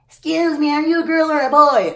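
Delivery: put-on high, loud child asking; little kid voice. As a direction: play a blunt little kid. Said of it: "Little kids are gonna be like".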